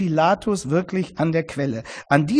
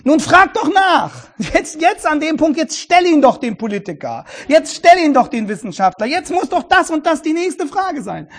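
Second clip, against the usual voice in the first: higher, louder, pressing to ask the follow-up question; urging the journalist; 8 semitones higher, 3 dB louder than usual. Retold or imitated. Preacher urging a journalist, impatient and pressing. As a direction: push them to ask, impatiently. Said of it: "den Journalisten am liebsten sagen".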